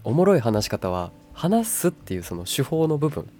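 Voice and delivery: ordinary talk, nothing marked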